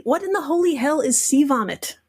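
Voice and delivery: normal talking voice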